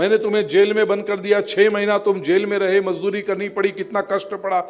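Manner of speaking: high, impassioned acted argument; challenging questioning. King questioning minister about his jail suffering, insistent challenge.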